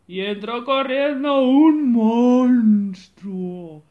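loud, slow and drawn out; dramatic entrance. A narrator's reading. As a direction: announce the monster's arrival. Monster bursts in, dramatic reading. (entrada dramática)

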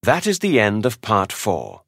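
ordinary talk, nothing marked

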